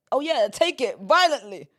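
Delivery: loud, slow exaggerated quote; mocking aggression. Host parodying how guest took her words, loud and aggressive. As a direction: blare the misheard version mockingly. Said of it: "how I said it wasn't like that".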